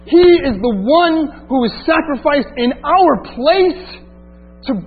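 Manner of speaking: rising, fervent preaching, slowing on the key words; preaching climax. Acted. Preacher telling his brothers and sisters Jesus is both, fervent. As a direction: build to the peak of the sermon.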